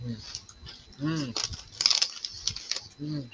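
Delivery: chewing while humming; humming with food in mouth; mouth full. Real, not acted. Seen 0: Chip put in mouth at -4; closed lips, jaw moving, humming after.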